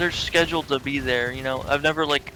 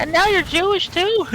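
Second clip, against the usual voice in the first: much higher, louder silly squeak; mock cartoon squeak; squeaky cartoon voice; 5 dB louder than usual. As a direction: squeal it in disbelief. Jumbled cartoon voices bit.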